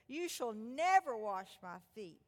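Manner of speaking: slow and insistent, leaning on never; insist, drawing out you shall never; emphatic. Acted. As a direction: Peter stubbornly refusing to let Jesus wash his feet. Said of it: "Peter says emphatically".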